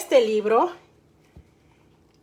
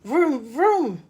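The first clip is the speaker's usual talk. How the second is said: tractor engine noise. A narrator's reading. Storyteller imitates the farm tractor, revving the engine. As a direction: rev it up like a tractor. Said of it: "hizo el tractor".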